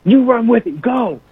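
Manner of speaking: high-pitched imitation of a coach; coach's order. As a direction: send the teammate off too, as the coach. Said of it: "he looks at Deshaun Cooper".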